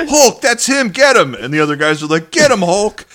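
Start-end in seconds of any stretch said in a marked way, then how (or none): 0.1-1.3 high, loud cheering yell; egging on the wrestler, high loud mock shout; mock excited yell. Retold yelling at Hulk. 2.3-3.1 high, loud crowd cheer; rally him like a fan; mock cheer. Other guys cheering.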